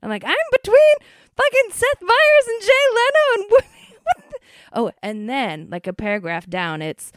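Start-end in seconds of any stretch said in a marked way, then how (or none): 0.2-4.4 very high, loud, crying-out squeal; ecstatic shriek. Retold or imitated. Telling the project manager she's among stars, shrieking. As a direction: wail it in overjoyed disbelief. Her reply about celebrity credits.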